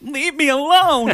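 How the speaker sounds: high, loud, slow shriek; mock terrified. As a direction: squeal it like a scared lady. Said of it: "she's like".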